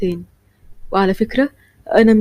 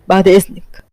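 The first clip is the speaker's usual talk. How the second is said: usual speaking voice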